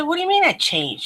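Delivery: high, quick puzzled comeback; retold disbelief. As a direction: act out being taken aback, pitched well up. Puzzled reply to friend's claim.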